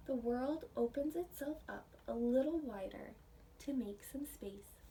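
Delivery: gentle storybook reading with hushed whispered stretches; reading to children. Acted. Teacher reading a picture book aloud to her class.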